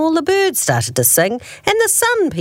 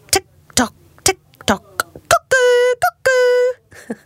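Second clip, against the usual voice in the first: ticking then high cuckoo calls; cuckoo clock; slower than usual. Acted. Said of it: "the living room clock".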